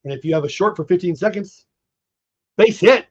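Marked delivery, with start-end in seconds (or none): 2.6-3.1 sudden loud burst, pitched up; excited call. Real, not acted. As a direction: call the hit like a broadcaster. Live call of a base hit.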